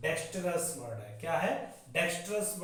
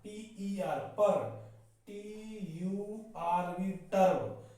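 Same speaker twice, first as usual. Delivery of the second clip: slow letter-by-letter, then the syllable; drawn-out classroom spelling of each syllable; slower than usual. Shown as a demonstration. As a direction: spell "पर" and "टर्ब" slowly, part by part. Teacher spells word by syllables.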